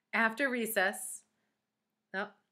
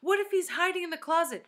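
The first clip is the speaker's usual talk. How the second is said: high, raised, worried question; begging warning; 11 semitones higher, 6 dB louder than usual. A narrator's reading. Jenny warning Myron the teacher may hide, anxious plea. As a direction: plead urgently, alarmed. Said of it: "begged Jenny".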